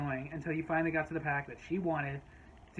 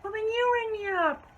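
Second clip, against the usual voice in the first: high, loud protest in a put-on voice; mock an outraged customer, pitched way up; hammed-up shrieky objection; indignant customer; 5 dB louder than usual. Acts the outraged customer demanding service.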